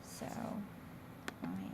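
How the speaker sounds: ordinary delivery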